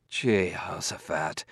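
muttered oath. Acted. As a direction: mutter it to yourself.

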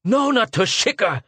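high scornful shout on No; shouted in derision. A narrator's reading. Schwartz scornfully shouting at Arviden, high and loud. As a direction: fling the name back at him, mocking. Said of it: "shouted Schwartz in wild derision".

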